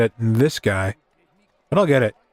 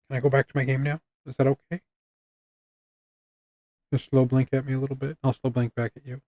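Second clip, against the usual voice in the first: softer, gentle plea to a cat; gentle coaxing; 2 semitones higher, 5 dB quieter, faster than usual. Real, not acted. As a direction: ask the cat sweetly for permission. Gently asking cat to leave.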